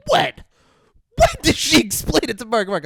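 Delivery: high, loud mock disbelief; incredulous. Podcaster mocking his own disbelief, high incredulous. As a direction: scoff it like you can't believe it.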